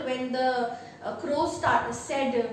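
ordinary talk, nothing marked

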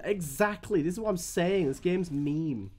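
usual speaking voice

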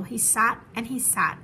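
ordinary delivery, nothing marked

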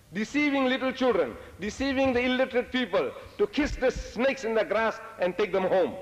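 ringing condemnation; indignant denunciation. Real, not acted. Denouncing deception he cannot stomach.

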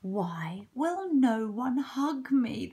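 slow, whispered and forlorn; sad whisper. Acted. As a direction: whisper it like a lonely little hedgehog. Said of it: "the hedgehog sniffed".

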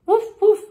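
high, loud playful woof woof; dog bark. Acted. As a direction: bark like a cheerful little dog. Dog barks in picture book.

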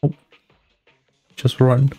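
ordinary talk

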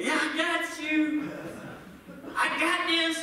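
mock confident, sure you'll beat the ding; cocky. Someone at the microwave boasting to it: slow, cocky.

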